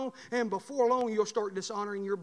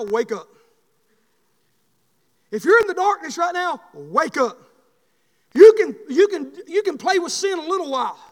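preaching; 8 semitones higher, 9 dB louder than usual. Acted. Preaching sermon.

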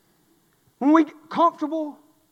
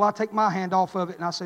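usual speaking voice

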